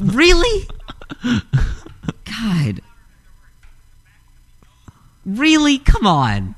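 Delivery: high squealing disbelief; exasperated shriek. Real, not acted. Keeps complaining about the guess.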